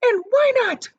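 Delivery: high, loud shrieked demand; shrieking. A narrator's reading. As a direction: shriek the question. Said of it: "shrieked Missus Gloop".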